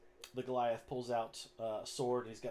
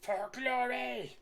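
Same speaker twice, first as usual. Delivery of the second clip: high, long-drawn announcer yell; yelled arena announcement; 12 semitones higher, 4 dB louder, much slower than usual. Acted. Goblin with homemade megaphone opens the gladiator fight, yelling out. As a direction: stretch every word out like a fight announcer. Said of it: "he yells out".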